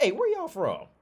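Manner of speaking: loud, very high mock call-out; high-pitched call. Asking the walking couple where they're from, high mock call. Acting out his question to couple.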